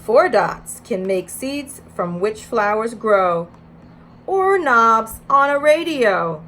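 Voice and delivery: put-on reading to children. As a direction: read it out to a preschool circle. Reads picture book aloud.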